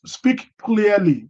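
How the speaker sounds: each word drawn out slowly and clearly. Shown as a demonstration. Reading a chat tip aloud, slow and deliberate. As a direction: read it out slow, spelling out clarity. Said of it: "in the chat says".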